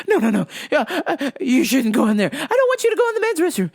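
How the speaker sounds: loud, stammering lady, climbing high and fast; mock flustered protest. Lady barring him from the men's restroom, mock flustered. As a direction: protest in a hammed-up panic. Lady objects, acted in panic.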